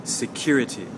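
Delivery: "security" with the CURE syllable stressed; stress on second syllable. Shown as a demonstration. Teacher modelling a word for learners, second-syllable stress on "security". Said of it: "stress now is on the second syllable".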